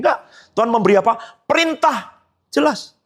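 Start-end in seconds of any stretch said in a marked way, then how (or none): none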